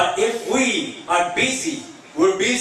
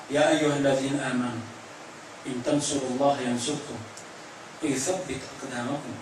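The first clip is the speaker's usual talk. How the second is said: low, soft, measured Arabic recitation; hushed, reverent scripture recital; Quran recitation; 6 semitones lower, 7 dB quieter, much faster than usual. Real, not acted. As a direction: recite the verse solemnly, pausing between phrases.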